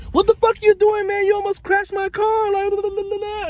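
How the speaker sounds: very high-pitched squawking imitation of an outburst; mock angry yell. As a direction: imitate him freaking out, voice shooting way up. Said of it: "he yells at me".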